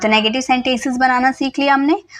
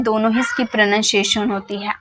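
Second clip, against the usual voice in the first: ordinary talk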